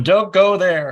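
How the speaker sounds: loud, high moviegoer's shout; mock warning yell. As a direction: imitate yelling at the characters on screen. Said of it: "We're yelling at 'em".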